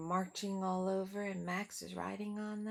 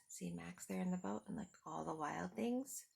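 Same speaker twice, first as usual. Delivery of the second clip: plain reading voice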